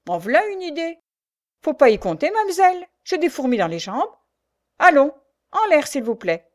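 high, loud, cheeky refusal; scoffing prisoner. A narrator's reading. Prisoner refuses Marianne and orders her up, scoffing. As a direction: brush her off, pitched well up.

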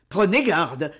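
usual speaking voice

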